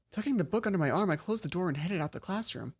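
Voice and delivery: normal talking voice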